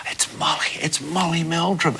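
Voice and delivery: excited-fan whisper, retold; soft, hushed aside among friends. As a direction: whisper it as if spotting a star. Kids spotting a celebrity nearby.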